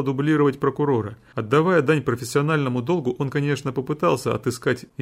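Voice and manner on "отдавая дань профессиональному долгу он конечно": ordinary delivery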